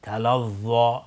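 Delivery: تلظی with heavy voiced th; tongue tip out for thick th in تلظی; correct za'. Shown as a demonstration. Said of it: "نوک زبان باید بیرون بیاد".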